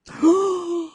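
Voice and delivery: high mock gasp at the title; dramatic gasp. Storyteller gasping at the episode title, high and theatrical. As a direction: gasp like it's a big reveal.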